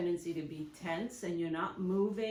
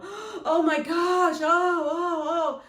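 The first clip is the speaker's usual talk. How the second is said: much higher, louder, slow horrified cry; panicked alarm; 9 semitones higher, 10 dB louder, much slower than usual. Shown as a demonstration. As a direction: gasp as if you just hurt the dog. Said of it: "the worst thing you can do is go".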